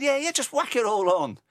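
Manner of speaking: chirpy high voice, egging her on; cheeky high-pitched urging. Retold or imitated. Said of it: "I said".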